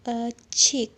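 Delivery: ordinary delivery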